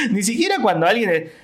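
high burst of laughter; laughs. Real, not acted. Comic laughing at his own joke mid-set, high laugh.

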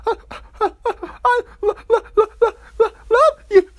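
very high, stuttering fake sobs; mock crying. Imitating pregnant ex crying in his face, mock crying. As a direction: choke out hammed-up squeaky sobs. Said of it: "she cried in my face".

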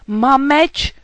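loud, blustering frightened knight; panicked threat. A narrator's reading. Frightened knight threatening the dragon with his sword, blustering. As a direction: bluster loudly to hold off danger.

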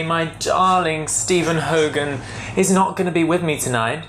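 ordinary talk, nothing marked

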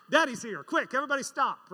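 put-on alarmed kids. Children caught misbehaving, loud alarmed call. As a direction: kids caught out, scrambling to stop.

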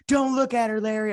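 high mock warning to Larry. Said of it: "as soon as he said".

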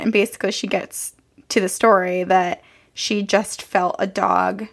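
ordinary delivery

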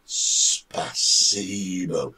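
"Spasibo" very slowly, syllable by syllable. Shown as a demonstration. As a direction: stretch out "Spasibo", spa-SEE-bo. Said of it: "Oh, slowly".

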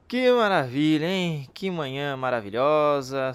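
sarcastic. Acted. Team lost, no tongue.

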